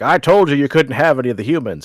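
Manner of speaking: a mock-stern president laying down the law; Eisenhower scolding aliens. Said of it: "he's like".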